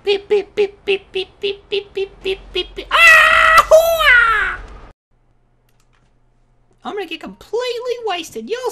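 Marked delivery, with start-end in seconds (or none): 0.0-2.9 even, repeated monotone beeps; imitated beeping. 2.9-4.6 long, high holler; loud scream. Real, not acted. Scream after countdown beeps.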